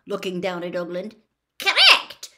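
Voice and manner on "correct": a sharp, much higher and louder retort; angry. Acted. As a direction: snap it back in irritation. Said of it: "said Ogden angrily".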